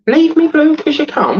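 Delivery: very high, incredulous rereading; baffled. Real, not acted. Streamer rereading a viewer's puzzling message, baffled. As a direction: repeat it in high-pitched disbelief.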